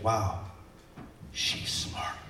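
soft, whispered amazement; mock awe fading to a whisper; hushed awe. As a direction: murmur it as if deeply impressed. People awed by big words.

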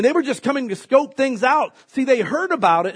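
usual speaking voice

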